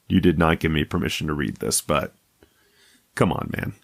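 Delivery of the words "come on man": usual speaking voice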